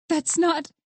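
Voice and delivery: high, broken-off protest; out of breath. A narrator's reading. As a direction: start to object, short of breath. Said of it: "She was out of breath".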